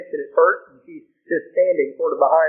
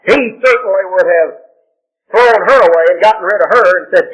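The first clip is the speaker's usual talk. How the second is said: usual speaking voice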